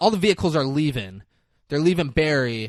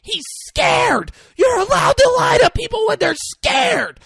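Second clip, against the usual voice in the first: very high, loud shouted retort; shouting back; 10 dB louder than usual. Retold or imitated. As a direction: yell it back in panic. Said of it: "And she goes".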